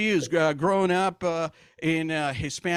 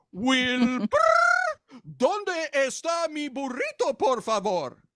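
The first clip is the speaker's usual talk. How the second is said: talking horse with a high whinnying trill; Mister Ed impression; 7 semitones higher, much slower than usual. Talking horse asks owner for burrito, hammed-up horse voice. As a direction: stretch the name into a neigh, then plead in Spanish. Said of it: "Mister Ed? Remember?".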